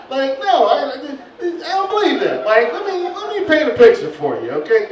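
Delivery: high, loud disbelief; incredulous. Real, not acted. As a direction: refuse to believe it out loud. Incredulous outburst.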